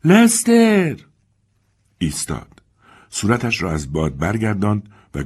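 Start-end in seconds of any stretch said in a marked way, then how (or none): 0.0-1.0 loud high call into the storm; mother shouting out the back door. Acted. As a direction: yell the boy's name into the wind. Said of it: "فریاد زد".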